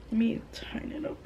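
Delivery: fast whispered aside. Real, not acted. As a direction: whisper it to yourself quickly. Quick aside to self.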